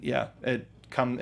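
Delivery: usual speaking voice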